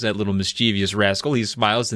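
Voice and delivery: usual speaking voice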